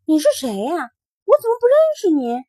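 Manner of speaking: high, quick, startled question; a child baffled by a stranger; surprised child. Acted. Mingming questioning the grey rabbit, high and startled.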